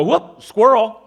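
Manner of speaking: a sudden loud distracted yelp, lightly voiced; distracted eyes. Preacher voicing eyes that chase the hanky, loud mock yelp. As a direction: blurt it out as if the eyes spotted a squirrel. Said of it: "your eyes go".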